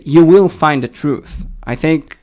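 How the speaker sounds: usual speaking voice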